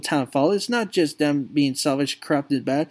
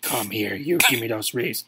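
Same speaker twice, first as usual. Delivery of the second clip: menacing father; 8 semitones lower than usual. Acted. Drunk father advancing on his son for the wreaths, low menace. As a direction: growl it low as you reach for him.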